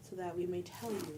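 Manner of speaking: trailing into a whisper. Real, not acted. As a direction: drop to a breathy whisper. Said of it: "I need to speak loud".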